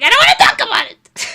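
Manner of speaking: high, loud and fast crying yell; sobbing shout. Retold or imitated. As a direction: shout it through tears, pushing him away. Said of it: "Like, sobbing".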